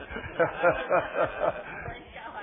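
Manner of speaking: real, high-pitched burst of laughter; hearty laugh. Real, not acted. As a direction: laugh heartily after the punchline. Laughs after punchline of testimony.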